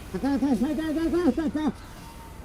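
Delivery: long, high cackle in character; mercenary's put-on laugh. Streamer in rapid-fire impressions, high character laugh. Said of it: "Here are my shitty impressions".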